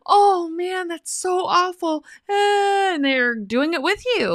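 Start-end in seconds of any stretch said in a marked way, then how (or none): 0.1-2.9 high, drawn-out mock sympathy; sympathetic girlfriend. Woman imitates girlfriends commiserating, high and slow. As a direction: coo along like a friend commiserating, pitched way up. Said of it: "they're just like".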